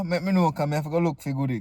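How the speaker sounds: ordinary delivery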